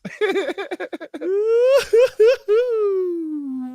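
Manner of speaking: long, high-pitched laughing fit; helpless laughter. Real, not acted. Co-host tickled by own story, helpless laughter. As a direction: crack up in a high, drawn-out laugh.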